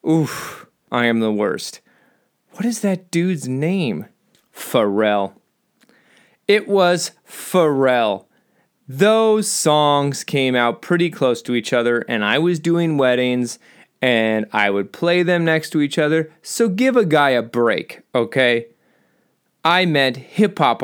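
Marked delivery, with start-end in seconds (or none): none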